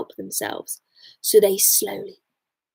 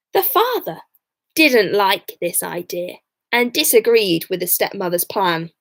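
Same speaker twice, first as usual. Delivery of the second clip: ordinary delivery, nothing marked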